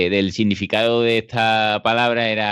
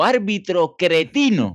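put-on a slow, higher old fan's jeer at the ref; 7 semitones higher, slower than usual. An old fan at the Betis ground jeers the referee, slow and high. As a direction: mimic an old football fan insulting the referee. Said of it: "le dijo".

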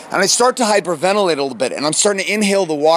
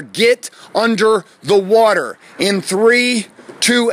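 slow, raised, emphatic order; insistent drill instructor countdown; stern instructor command; 6 semitones higher, 4 dB louder, much slower than usual. Retold or imitated. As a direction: spell out the order firmly, pitched up.

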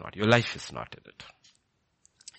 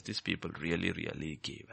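quiet, lower repeat of "really, really gave"; hushed echo; 5 semitones lower, 8 dB quieter than usual. Shown as a demonstration. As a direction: drop to a soft, low "really, really gave". Same line repeated much softer.

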